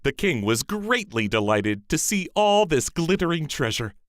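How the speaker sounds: ordinary delivery, nothing marked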